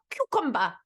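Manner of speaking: mock Spanish accent on "Cucumber"; pronounced Spanish accent. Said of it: "in a pronounced Spanish accent".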